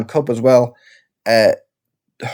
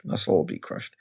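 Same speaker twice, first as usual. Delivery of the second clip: whispered, much softer; hushed mock heartbreak; mock despairing whisper; 5 semitones lower, 10 dB quieter than usual. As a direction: breathe it out like a defeated fan. Mock lament, whispered echo.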